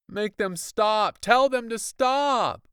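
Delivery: high, loud, slowed plea; crying out. Acted. Trembling boy crying out to stop the music. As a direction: beg desperately, hands over ears. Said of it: "I cried".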